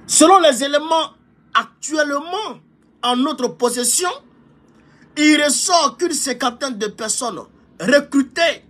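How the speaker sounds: official report reading. Shown as a demonstration. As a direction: read the intelligence report aloud. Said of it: "je vais vous donner lecture du rapport".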